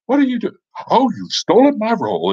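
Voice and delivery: high-pitched hammed-up outrage; mock indignation. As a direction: squawk like an upstaged actor. Actor indignant at stolen role.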